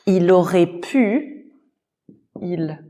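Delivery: ordinary delivery, nothing marked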